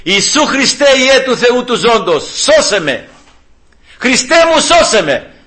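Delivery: high, fervent cry to Christ; calling out. Shown as a demonstration. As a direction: model an urgent prayer for rescue. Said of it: "να φωνάξεις".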